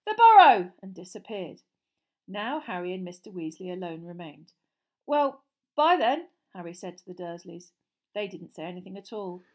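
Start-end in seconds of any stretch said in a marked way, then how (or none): 0.0-0.7 loud high shout. Acted. 5.1-6.3 awkward goodbye. Acted.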